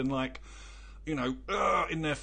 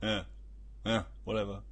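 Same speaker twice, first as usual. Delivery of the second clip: low, quiet dismissive grunting; half-voiced surly mumble, pitched down; bad-day grunt; 6 semitones lower, 6 dB quieter than usual. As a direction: imitate a grumpy Brit brushing someone off. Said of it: "we tend to go".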